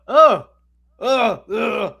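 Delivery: high, loud mock groans of dismay; disgusted groans. Hearing the actress really speak, mock disgusted groans. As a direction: groan as if appalled by the voice. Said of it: "when you hear her speak".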